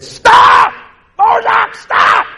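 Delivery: high, loud shout; desperate yell. Retold or imitated. Owner shouting at his dog running into traffic, high loud shout. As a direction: scream to stop the dog before the road.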